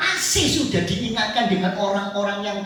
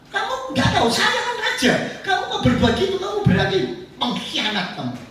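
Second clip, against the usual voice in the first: indignant king; 11 semitones higher than usual. Acted. Saul accuses Abimelek.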